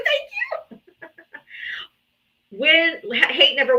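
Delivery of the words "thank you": put-on high, squeaky little-kid thank you; imitating her daughter. Mom imitates her daughter thanking a donor, high child voice. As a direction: chirp it like a small child. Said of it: "She goes".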